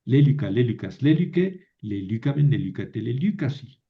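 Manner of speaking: Lélica, lélicas, lélique on LÉ, then lelícamen, lelícate, lelícasi on LÍ; LÉ-li-ca series, then le-LÍ-ca-men series, as a drill; model paradigm stresses. Shown as a demonstration. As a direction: recite with the accent moving from LÉ in lélique to LÍ in lelícamen. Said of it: "Y así se lo aprenden".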